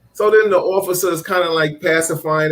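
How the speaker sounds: ordinary talk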